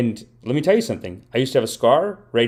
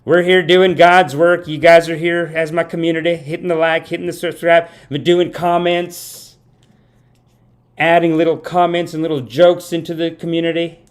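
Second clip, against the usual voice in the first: mock Southern preacher; 6 semitones higher, 5 dB louder than usual. Mock preacher rallying his community.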